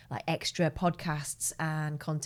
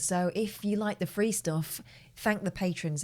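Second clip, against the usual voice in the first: usual speaking voice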